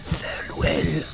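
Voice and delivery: slow breathy whisper; whispered farewell. Acted. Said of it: "heard her whisper".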